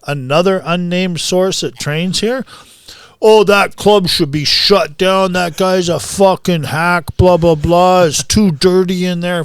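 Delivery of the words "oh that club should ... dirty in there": higher, louder, imitated badmouthing; mocking retold complaint. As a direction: quote a critic's rant scornfully. Mocking retold trash talk.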